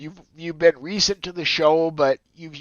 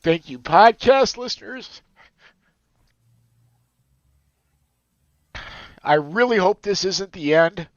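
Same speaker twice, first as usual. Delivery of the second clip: tearful, pushing the words out louder; emotional; 5 dB louder than usual. Real, not acted. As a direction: overcome while saying goodbye to listeners. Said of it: "I wouldn't get all emotional".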